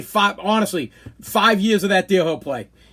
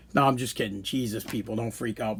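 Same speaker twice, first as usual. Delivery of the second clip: quiet aside; 8 semitones lower, 9 dB quieter than usual. Real, not acted. Backpedal after a joke.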